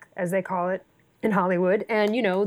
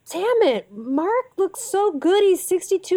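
much higher, slow, marvelling; amazed exclamation; 10 semitones higher than usual. Retold or imitated. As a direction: gush in disbelief about how good he looks. Retold text marvelling at Mark.

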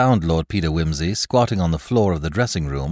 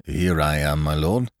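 respectful reproach; 2 semitones lower than usual. Acted. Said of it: "said Mister Bunter with respectful reproach".

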